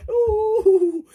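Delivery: high loud wailing ooh; loud sobbing ooh; crying wail. Real, not acted.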